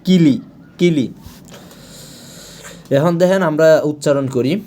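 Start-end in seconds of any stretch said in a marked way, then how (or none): 0.0-1.1 কিলি said quickly as kili; light short first vowel in কিলি; shortened ending. Shown as a demonstration. Teacher modelling the rule for word endings, short crisp কিলি. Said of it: "এটা সরাসরি হয়ে যাবে কিলি".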